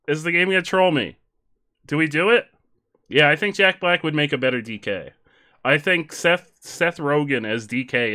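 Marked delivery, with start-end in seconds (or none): none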